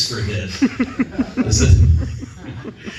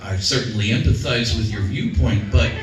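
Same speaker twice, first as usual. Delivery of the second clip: overly polite collector; 6 semitones lower than usual. Retold or imitated. Collector's polite reply to abuse.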